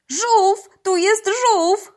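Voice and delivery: high, loud cry of discovery; excited cry. A narrator's reading. Pola spots a turtle underwater, excited cry. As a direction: call it out high, stretching the words. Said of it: "chciała krzyknąć Pola".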